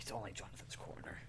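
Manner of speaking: soft eerie whisper; spooky whisper. Pretend. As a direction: hush it like a haunting. Spooky voice behind the door.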